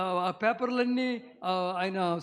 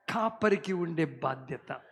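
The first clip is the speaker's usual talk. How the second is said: ordinary talk, nothing marked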